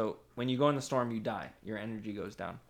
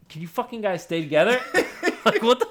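louder, higher exasperated shout; mock frustrated yell; 8 semitones higher, 9 dB louder than usual. Player yelling at his teammates, loud and high. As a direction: yell at the scattering team.

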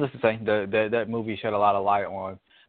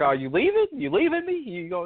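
a mock stunned, pleading question; high, baffled voice asking if he's really leaving; incredulous "you're leaving"; 11 semitones higher than usual. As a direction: pitch shooting up high in disbelief. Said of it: "Wesley Snipes' character's like".

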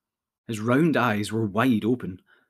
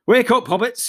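higher, louder wake-up call; cheerful cry; 10 semitones higher, 5 dB louder than usual. A narrator's reading. Frodo calls the sleeping hobbits awake, loud. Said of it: "he cried".

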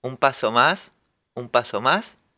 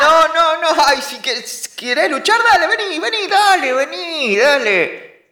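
high teasing come-at-me; high taunting challenge; 13 semitones higher than usual. Acted. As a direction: dare her to fight. Brother taunting to fight.

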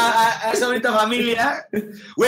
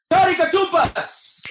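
high, slow show announcer call; 7 semitones higher, much slower than usual. Acted. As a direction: announce it like a game show host. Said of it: "announcing the game segment".

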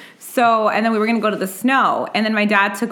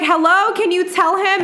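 retold yelling; 9 semitones higher than usual. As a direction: demand backup, pitched way up.

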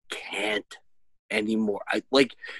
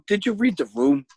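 higher-pitched exasperated questioning; retold scolding, voice rising; 5 semitones higher than usual. Dad telling his kid to read the room, exasperated.

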